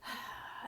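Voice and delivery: a quiet, breathy sigh; soft sigh. Shown as a demonstration. Showing how she sighed at the card, soft sigh. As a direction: sigh out softly, deflated. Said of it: "and I sighed like that too".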